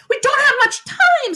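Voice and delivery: high, loud, urgent; urgent shout. A narrator's reading. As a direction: blurt it out in alarm. Catherine urgent.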